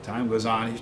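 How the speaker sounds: ordinary delivery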